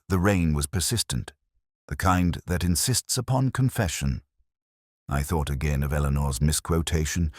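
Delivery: usual speaking voice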